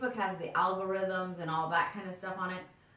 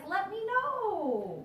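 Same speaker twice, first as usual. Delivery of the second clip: drawn out; 12 semitones higher, much slower than usual. Real, not acted. Stretched plea to friends.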